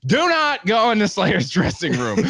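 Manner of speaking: high, emphatic parody of official backstage rules; mock rule recital. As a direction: list the rules like a strict bouncer, with mock gravity.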